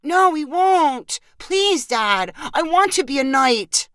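high, drawn-out begging; pleading child. A narrator's reading. Boy begs dad for a toy sword, pleading. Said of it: "replied with a pleading voice".

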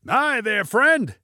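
high, loud hail; shouted greeting. A narrator's reading. Pilot greets hitchhiker, high shout. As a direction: hail him high from afar. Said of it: "shouted Junie".

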